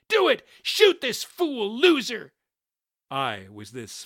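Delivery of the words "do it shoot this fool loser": high, loud frantic yell; shouted order. A narrator's reading. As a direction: yell to an accomplice to fire. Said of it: "Shotgun shouted".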